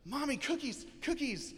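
put-on high-pitched excited toddler voice; hammed-up, high little-kid plea to mom; little girl begging. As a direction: squeal for treats like a small child. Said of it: "the little girl right away".

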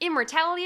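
"immortality" बोलते हुए ऊँचा और ज़ोरदार; misreading emphasized. Shown as a demonstration. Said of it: "I read it as".

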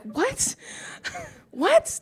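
high-pitched, incredulous "what?"; mock disbelief. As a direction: replay your stunned reaction with a laugh. Retelling her shocked reaction to husband.

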